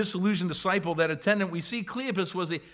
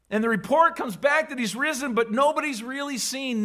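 ordinary delivery

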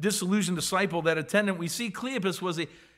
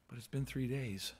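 lower, much softer, hopeless; discouraged, deflated; 7 semitones lower, 11 dB quieter than usual. Acted. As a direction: let it sink with no hope left. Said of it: "the disappointment and discouragement".

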